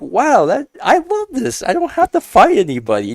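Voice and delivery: very high-pitched, delighted wonder; acted amazement. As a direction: relive being wowed, voice shooting up. Retelling amazement at supportive rivals.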